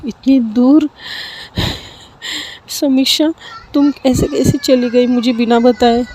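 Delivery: calling out to her absent friend, heartbroken; crying harder. Acted. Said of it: "Mishka और भी रोने लगती है".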